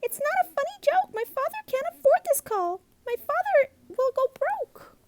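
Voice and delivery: very high, loud panic; panicked girl. A narrator's reading. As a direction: plead it in a frenzy. Sister panics over bill.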